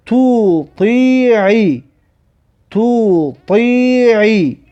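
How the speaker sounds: slow syllables, vowels held long; drawn-out model reading. Shown as a demonstration. Teacher models an example for learners, slow and drawn out. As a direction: stretch each syllable of "تو طیعی" evenly. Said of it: "این مثال را بخوان".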